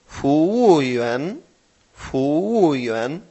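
"fu wu yuan" drawn out syllable by syllable; very slow Mandarin, tones exaggerated on "fu wu yuan"; slow word model. Shown as a demonstration. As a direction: teach "fu wu yuan", stretching each tone. Teacher models a new word.

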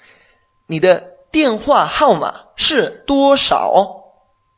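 slow, careful teacher's Chinese, every syllable clear; spoken slowly for a student to copy; slow model sentence. Shown as a demonstration. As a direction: lay out the question slowly for the learner. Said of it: "ahí es donde tenemos que colocar la partícula interrogativa".

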